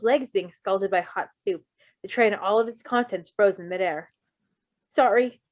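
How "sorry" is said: choked out. A narrator's reading. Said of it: "Virgil choked out".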